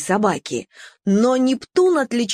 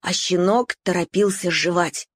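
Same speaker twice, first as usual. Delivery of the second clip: ordinary delivery, nothing marked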